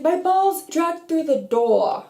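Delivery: put-on high, slow Jersey accent. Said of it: "I can't do accents".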